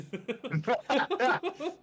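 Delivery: high, loud cackling laugh; loud laughter. Real, not acted. Player amused by Nicholas's note, loud laughter.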